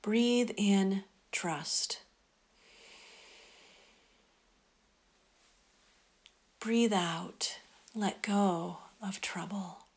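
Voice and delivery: softly whispered, slow breath prayer; whispering. Acted.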